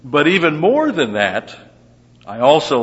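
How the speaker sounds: loud, high, building emphasis; rising emphatic paraphrase; emphatic buildup. Retold or imitated. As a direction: push it up like a preacher. Voicing Paul building argument.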